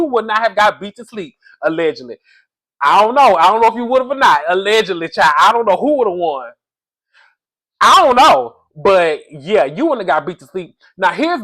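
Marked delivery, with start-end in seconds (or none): 2.8-8.6 mock doubt. Real, not acted. Teasing doubt, loud and high.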